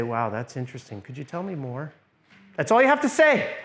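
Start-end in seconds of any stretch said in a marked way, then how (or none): 0.0-1.9 soft, lower, calming question to someone uneasy. Shown as a demonstration. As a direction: speak quietly so she feels safe. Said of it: "Lower your voice, quiet, turn down the volume".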